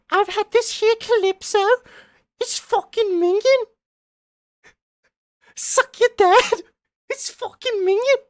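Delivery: very high-pitched mock chav British accent. Streamer showing chat the chav dialect, squeaky chav accent. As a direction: do a hammed-up, squeaky English chav. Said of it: "I can't do chav".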